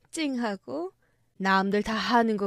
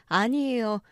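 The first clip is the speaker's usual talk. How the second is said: ordinary delivery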